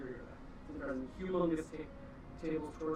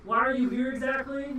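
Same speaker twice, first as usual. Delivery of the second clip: loud, higher challenge; hostile demand; 7 semitones higher, 8 dB louder than usual. Acted. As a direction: demand an answer as a guard. GM as suspicious orc guard.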